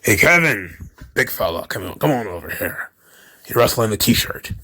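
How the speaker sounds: low, soft sly voice fading to a murmur; lightly voiced scheming boss calling a wrestler over; Vince impression. Imitating Vince addressing Kevin Owens.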